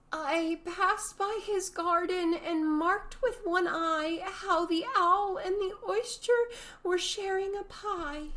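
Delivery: high, slow, nervous recitation; trembling voice. A narrator's reading. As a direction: recite timidly, afraid to get it wrong. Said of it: "in a trembling voice".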